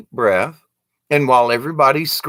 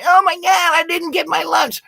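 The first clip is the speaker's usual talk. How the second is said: put-on much higher, childish whining voice; whiny little kid. Mocking overreacting adults as a high whiny kid. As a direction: play a kid wailing over lunch. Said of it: "a little kid in the corner going".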